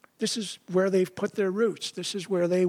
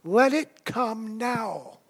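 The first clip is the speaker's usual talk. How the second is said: higher, slow, taunting dare; slow, higher mock defiance; 5 semitones higher, much slower than usual. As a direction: drag out "Let it come now" as a sneering challenge. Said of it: "What they're really saying is".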